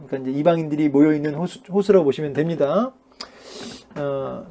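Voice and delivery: ordinary talk, nothing marked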